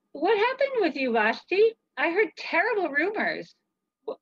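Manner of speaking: usual speaking voice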